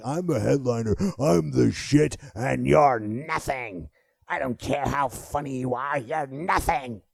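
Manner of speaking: smug, drawn-out arrogant sneer; arrogant headliner. Retold or imitated. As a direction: talk down like a vain star. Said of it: "It's not like".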